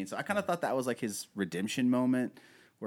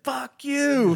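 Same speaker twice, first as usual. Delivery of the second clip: high, loud furious yell; mock outburst at the man who shot him; angry yell; 11 dB louder than usual. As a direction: spit it at him in a rage. Angry wounded soldier quote.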